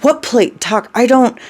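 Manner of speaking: high, flustered protest; panicked confusion. Acted. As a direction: answer helplessly, in a fluster. Confused frightened reply, cut off.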